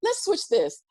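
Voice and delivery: mock company voice. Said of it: "for YouTube ... to go".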